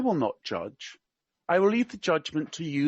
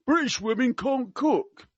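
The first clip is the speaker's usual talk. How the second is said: high, slower mock-Cockney pronouncement; attempted Cockney; 8 semitones higher, 3 dB louder than usual. Quoting the prince on British women, in attempted Cockney. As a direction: declare it in your best try at Cockney. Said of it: "I can't do Cockney. I will try my best".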